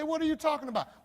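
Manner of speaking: fast, loud, very high mock bafflement; baffled reaction. Listener questioning old-fashioned English, loudly baffled. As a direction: blurt it out high as a confused listener.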